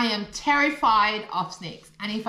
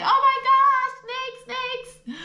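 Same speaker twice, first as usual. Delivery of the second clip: high, frightened cry at the snakes; panicked shriek; 13 semitones higher, slower than usual. Retold or imitated. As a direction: squeal it like you're running for your life. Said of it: "ran for my life saying".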